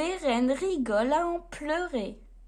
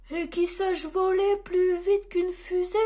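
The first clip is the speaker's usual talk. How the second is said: lightly voiced Santa, a bit higher; Père Noël; 4 semitones higher, 3 dB louder than usual. A narrator's reading. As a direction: muse aloud, adding to the wish list. Santa keeps listing requirements.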